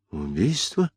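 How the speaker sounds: hushed, questioning echo; soft, shocked question under the breath; stunned disbelief. A narrator's reading. Mother hearing her son is accused of murder, stunned.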